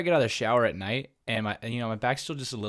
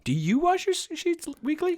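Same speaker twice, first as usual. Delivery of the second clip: high incredulous question; shocked disbelief. Real, not acted. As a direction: squeak it out in disbelief. Shocked at weekly washing.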